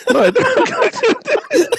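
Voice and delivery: high, drawn-out laughing protest; laughing denial. Real, not acted. Teased by friends for yelling at movies, laughing denial. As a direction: protest helplessly through real laughter.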